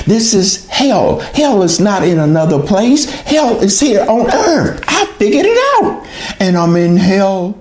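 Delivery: retold outburst, voice climbing high; anguished outcry. Woman retelling her search for escape from hell, anguished. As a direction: cry it out in rising, despairing insistence.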